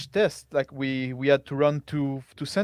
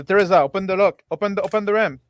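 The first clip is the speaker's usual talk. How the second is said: urgent shout; 5 semitones higher, 5 dB louder, faster than usual. Acted. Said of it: "And say".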